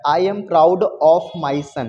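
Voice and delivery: each word read out slowly and clearly for the class; a teacher dictating, stretching every word; slow model sentence. Shown as a demonstration. Hindi-speaking teacher reads the model sentence to students, slow and deliberate. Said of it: "हमेशा याद रखो कि proud के साथ of लगता है".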